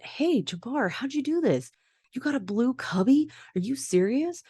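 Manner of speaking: dad selling the blue cubby with over-the-top enthusiasm; hyped-up dad. Retold or imitated. Dad hyping up nervous son.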